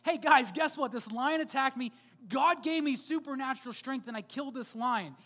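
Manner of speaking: loud excited boast to friends; bragging excitedly. Retold or imitated. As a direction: shout it out, proud and thrilled. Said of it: "I'd be like".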